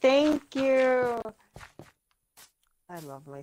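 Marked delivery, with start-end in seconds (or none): 0.0-1.2 mock grateful. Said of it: "I was like".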